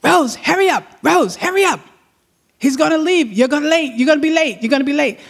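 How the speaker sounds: husband calling up the stairs to Rose, mock yelling; retold yelling. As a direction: hurry someone along in a high, mild yell. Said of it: "person was yelling upstairs".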